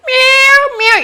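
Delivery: very high, loud imitated meows; cat meow imitation. Shown as a demonstration. Teacher sounding out a cat for listeners, high imitated meowing. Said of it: "It doesn't mean cat voice".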